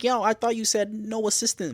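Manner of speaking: high-pitched challenging quote; mock objection. Mari objecting to helping Natalie, high-pitched challenge. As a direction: raise the voice to object.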